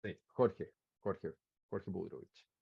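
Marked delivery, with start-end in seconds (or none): none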